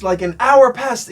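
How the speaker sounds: high, loud retold complaint; imitated yell, drawn out; mock angry shout. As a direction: holler it slowly like the heckler. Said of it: "he's just shouting".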